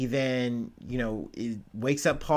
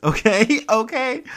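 high, louder okays through a laugh; 9 semitones higher, 7 dB louder than usual. Real, not acted. Vlogger recovering from laughter, high laughing okays. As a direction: settle down while still laughing.

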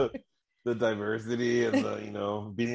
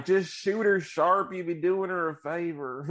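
put-on Texas accent; 6 semitones higher than usual. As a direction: mimic her thick Texas accent. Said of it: "She's like".

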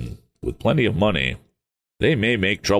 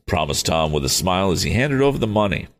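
ordinary delivery, nothing marked

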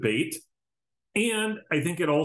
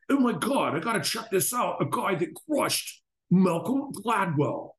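high-pitched, hammed-up amazement; mock excitement; 8 semitones higher than usual. Podcaster retelling his reaction to a debate video, mock excited. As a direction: play up the thrilled discovery.

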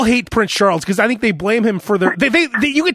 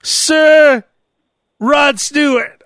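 mock royal announcement; 4 semitones higher, 3 dB louder, much slower than usual. Mock knighting ceremony.

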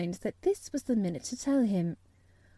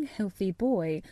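usual speaking voice

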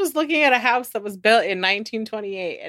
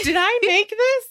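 puzzled, high-pitched wondering; confused question; 11 semitones higher than usual. Pretend. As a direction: ask it baffled. Confused person in kitchen.

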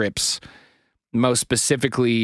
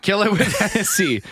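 laughing; 10 semitones higher than usual. Real, not acted. Echoing friend's joke, laughing.

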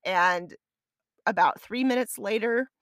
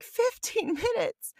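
very high, slow shocked question; shocked disbelief; 13 semitones higher, slower than usual. Retold or imitated. Runner hearing the finish closes soon, high shocked disbelief. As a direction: gasp it out in disbelief. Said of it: "And I'm like".

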